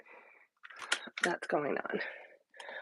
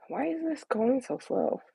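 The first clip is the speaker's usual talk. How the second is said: normal talking voice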